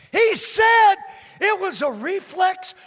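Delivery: high, very loud pulpit shout; preacher crying out the key line; shouted preaching. Real, not acted. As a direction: hammer the punchline home at full force. Preacher shouts the punchline to congregation.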